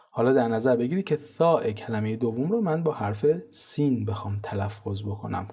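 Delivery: ordinary talk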